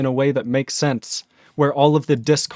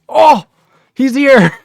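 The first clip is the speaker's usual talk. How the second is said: much higher and louder, thrilled; excited squeal; 11 semitones higher, 10 dB louder, slower than usual. Real, not acted. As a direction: starstruck yelp of joy. Streamer thrilled by famous viewer.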